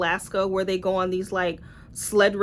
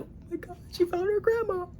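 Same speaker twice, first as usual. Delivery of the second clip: higher, mock-tearful gasp of fake sentiment; mock emotional gush; 10 semitones higher, 6 dB quieter than usual. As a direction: overplay being moved, sarcastically. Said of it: "I'm supposed to be like".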